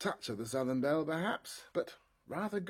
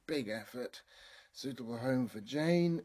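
normal talking voice

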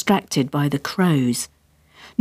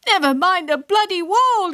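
high-pitched angry retort; shrieky outraged interruption; furious yell; 13 semitones higher than usual. A narrator's reading. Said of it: "yelled Jenny".

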